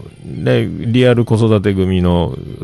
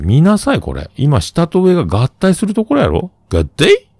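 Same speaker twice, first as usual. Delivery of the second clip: put-on higher, bossy explaining to a toddler; mock lecturing adult; 9 semitones higher than usual. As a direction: lecture the kid like a peer. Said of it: "っつって、三歳の子に".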